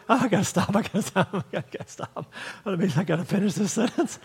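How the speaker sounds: giggling through the words; can barely get it out for laughing. Retold or imitated. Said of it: "might have even been laughing when he wrote".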